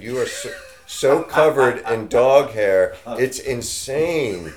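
amazed, drawn-out exclamation; excited. Real, not acted. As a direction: marvel loudly at the dog hair. Talking to the shedding dog.

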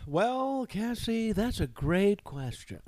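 hammed-up pleased interview guest; mock pompous interviewee. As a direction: act flattered by the question.

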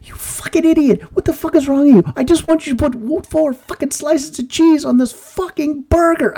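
high, strained mock rage; mock freak-out. Customer losing it at the drive-through attendant, high-pitched mock rage. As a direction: blow up in a high squeal of fury. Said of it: "he's like".

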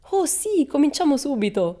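high, loud, eager yell; gleeful yell. Acted. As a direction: shout with mischievous delight. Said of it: "urlò".

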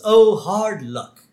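high, slow British sympathy; mock-British commiseration, drawn out; British accent. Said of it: "Apparently he's British".

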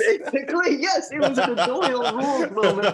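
giddy laughing yes. Real, not acted. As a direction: answer while cracking up. Laughing while agreeing.

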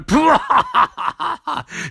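high gloating villain cackle; evil cackle. Retold or imitated. Eiling gloating over knocked-down Wrongy, high evil cackle. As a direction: cackle over the fallen hero. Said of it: "and Idling's like".

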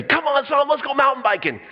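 high, bright mock dad calling his son; eager dad voice. Dad voiced in a retold scene.

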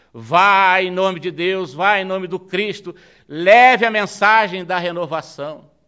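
acted fervent blessing; solemn exhortation. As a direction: send him forth with preacher's force. Acted papal blessing and sending forth.

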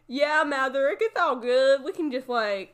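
hammed-up trashy Southern accent. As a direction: play a dim lowlife with a Southern accent. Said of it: "I was doing a southern accent with that".

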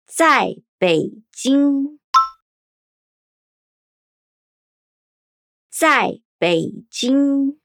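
syllable by syllable; 在北京 said slowly and clearly as zài Běijīng; slow Mandarin demo. Shown as a demonstration. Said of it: "Está em Pequim".

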